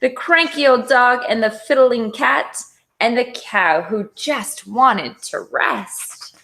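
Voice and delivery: usual speaking voice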